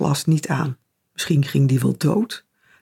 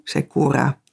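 plain reading voice

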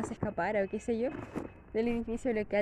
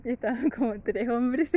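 normal talking voice

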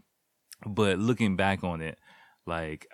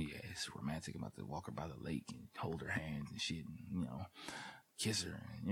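much softer, hushed dreamy musing; inner thoughts; 3 semitones lower, 14 dB quieter than usual. Retold or imitated. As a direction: murmur your hopeful plan to yourself. His inner thoughts on date.

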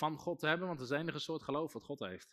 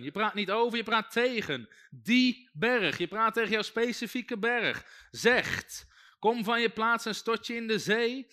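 loud, slow, higher preaching; full-force preaching; 5 semitones higher, 7 dB louder than usual. Acted. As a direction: preken op volle kracht, traag en luid. (predikant preekt luid en langzaam tot de gemeente)

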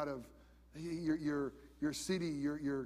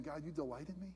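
hushed prayer; 8 dB quieter, faster than usual. Retold or imitated. Preacher voicing his own prayer.

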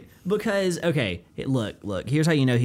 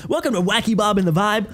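louder hyped DJ greeting again; morning radio host; 5 semitones higher, 4 dB louder than usual. Shown as a demonstration. Repeating the morning radio greeting, hyped DJ delivery. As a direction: pump up the show intro. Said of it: "favorite voice is a morning radio host".

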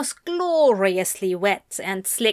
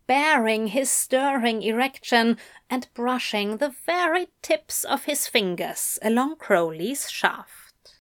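plain reading voice

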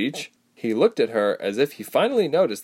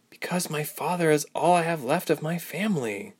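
sighing; 7 semitones higher, 3 dB quieter than usual. A narrator's reading. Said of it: "Ilse sighed".

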